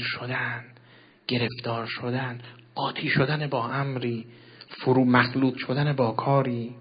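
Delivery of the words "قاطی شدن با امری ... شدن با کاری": usual speaking voice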